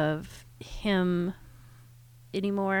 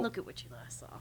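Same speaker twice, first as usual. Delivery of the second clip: whispered quote; 11 dB quieter than usual. Retold or imitated. Quoting the verse, whispered.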